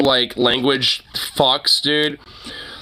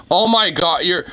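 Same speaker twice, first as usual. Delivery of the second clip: ordinary talk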